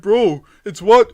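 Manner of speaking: high, loud, slow correcting voice; mocking viewers. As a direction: mimic a fussy viewer correcting him. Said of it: "And they're like".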